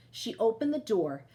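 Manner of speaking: ordinary delivery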